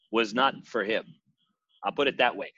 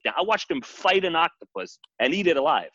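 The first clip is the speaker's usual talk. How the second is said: usual speaking voice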